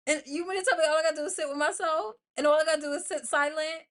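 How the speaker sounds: fast, high, loud disbelief; mock incredulous. Real, not acted. Podcaster mocking her own disbelief, fast and loud. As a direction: rattle it off in mock shock.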